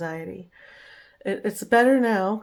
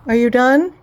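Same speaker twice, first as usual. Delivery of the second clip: calling the dog; 5 semitones higher, 11 dB louder than usual. Real, not acted.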